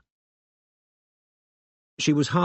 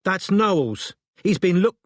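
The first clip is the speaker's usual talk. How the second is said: high, raised voice, cut off mid-word; raised London woman's telling-off; much louder; 9 semitones higher, 4 dB louder, slower than usual. A narrator's reading. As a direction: scold a child loudly, breaking off abruptly. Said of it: "and then, much louder".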